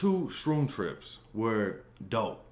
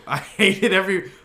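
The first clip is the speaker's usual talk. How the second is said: laughing; 10 semitones higher, 11 dB louder than usual. Real, not acted. Laughing through a bad trip story.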